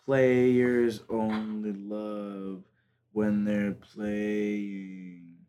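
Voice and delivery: drawn-out slow recital; very slow reading. Shown as a demonstration. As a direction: stretch every word out. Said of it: "reading lyrics very slowly".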